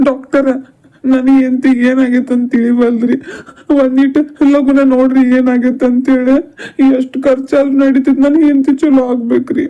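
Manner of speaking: loud, frantic begging to the doctor; a husband desperately begging the doctor to save his wife; desperate pleading. Acted.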